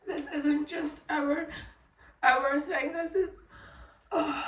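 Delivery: holding back real sobs; crying. Real, not acted. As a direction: voice breaking with tears. Breaking down over daughter.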